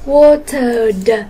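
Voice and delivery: "Watered" said loudly, closed on a released d; final d sound. Shown as a demonstration. Teacher answers the exercise, "Watered" ending in a d sound. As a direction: land "Watered" on a clear d. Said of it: "còn lại thì chúng ta đọc là d".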